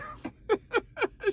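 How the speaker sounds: high burst of laughter; real burst of laughter; laughing. Real, not acted. Speaker laughing at the scene.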